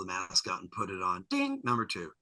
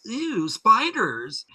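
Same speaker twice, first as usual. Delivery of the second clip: mock disgust; 11 semitones higher, 8 dB louder, much slower than usual. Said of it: "everybody that sees it says".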